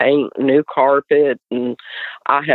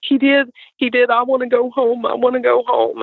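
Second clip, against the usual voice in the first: high, imitating a tearful boy; crying friend; 10 semitones higher than usual. Son's friend begging to go home, high mock crying. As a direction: plead to go home like a scared kid. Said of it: "He was like".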